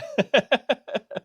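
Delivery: loud high burst of laughter. Real, not acted. Laughing at end of exchange.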